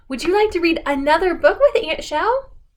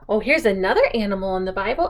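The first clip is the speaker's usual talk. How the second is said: plain reading voice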